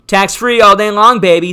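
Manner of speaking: loud, high, emphatic declaration; excited. Real, not acted. Host answering his viewers with loud, excited emphasis. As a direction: sell it like the answer is obvious.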